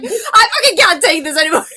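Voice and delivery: loud, laughing mock despair. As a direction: laugh through a mock breakdown. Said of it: "You're just like".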